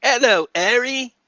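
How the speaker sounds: high, loud mock Cockney; hammed-up Cockney with dropped H's; Cockney accent. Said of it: "Where she drops her H's".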